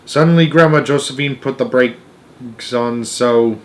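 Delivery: ordinary delivery, nothing marked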